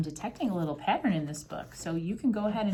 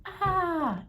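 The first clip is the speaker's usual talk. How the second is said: long, high-pitched frightened "ah"; performed scream of surprise; startled yell; 12 semitones higher, 4 dB louder than usual. A narrator's reading. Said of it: "The lady yelled".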